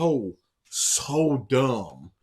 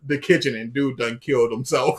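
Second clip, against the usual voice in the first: usual speaking voice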